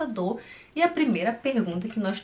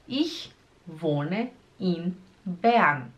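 ICH, WOHNE, IN, BERN, each set apart; very slow "Ich wohne in Bern", pausing between words; 2 semitones lower, much slower than usual. Shown as a demonstration. Teacher breaking the sentence down for learners, slowly.

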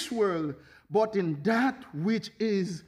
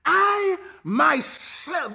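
"I myself" very slow, every word leaned on; emphatic stress; 7 semitones higher, 5 dB louder, much slower than usual. Acted. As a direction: drag out "I myself" and lean hard on "I". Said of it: "the Greek is ego eimi".

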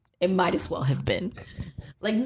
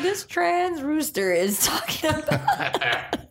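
slow, raised disbelief; incredulous; 8 semitones higher, much slower than usual. Retold or imitated. Reacting to the cartoon rooster, slow and raised. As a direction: drag it out in amused disbelief.

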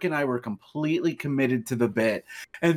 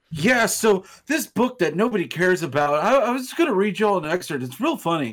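quick, higher, mocking a friend's reading voice; imitating a friend, pitched up and rushed; mocking imitation; 6 semitones higher than usual. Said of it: "and you're just like".

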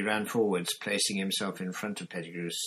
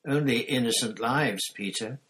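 louder, bitter retort; fury; 4 semitones higher, 6 dB louder than usual. A narrator's reading. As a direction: drive the point home angrily. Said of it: "with a terrible fury".

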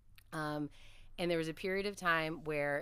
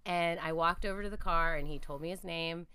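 ordinary talk, nothing marked

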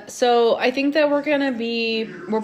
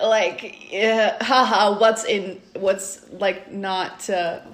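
ordinary talk

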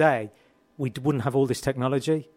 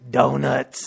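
drawn-out cartoon craving for a treat; Homer Simpson. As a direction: savour it like a greedy cartoon dad. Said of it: "influenced by Homer Simpson".

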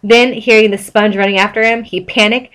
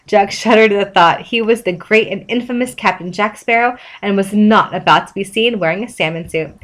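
ordinary delivery, nothing marked

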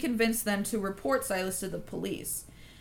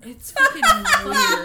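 high, loud laughing exclamation; 9 dB louder than usual. Real, not acted. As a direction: laugh it out in disbelief. Laughing at the bizarre story.

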